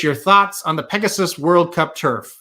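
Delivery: usual speaking voice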